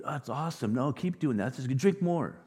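enemy cheering on a reckless life, mock sweet; flattering enemy. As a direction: egg them on like a fake friend. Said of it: "Your enemies will blow smoke".

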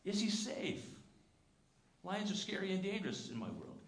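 put-on high, worried little girl asking; a child's fearful question, pitched high; anxious young Lucy. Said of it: "Lucy says".

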